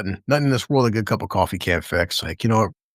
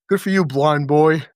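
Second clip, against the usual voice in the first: sarcastic Frank voice; 4 semitones higher, 4 dB louder than usual. Retold or imitated. Voicing Frank mocking Matt.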